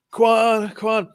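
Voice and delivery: high, gushing eager greeting; starstruck, over-keen hello; intense fan. Retold or imitated. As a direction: overeager fan rushing up to his idol. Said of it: "I was, like, really intense".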